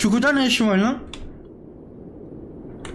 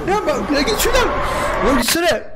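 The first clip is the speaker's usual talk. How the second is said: panicked shooing; 9 semitones higher than usual. Real, not acted. Shooing the monster away.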